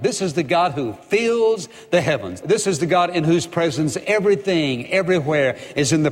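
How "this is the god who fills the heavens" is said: ordinary delivery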